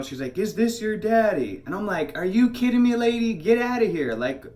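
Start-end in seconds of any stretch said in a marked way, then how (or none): 0.4-1.6 high, slow, loud mimicry of the woman; put-on high lady voice, slow and loud; lady questioning child. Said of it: "she's talking to the girl". 2.2-4.4 raised, high-pitched indignant comeback; heated retold protest, pitched up; angry retort. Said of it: "starting to get angry".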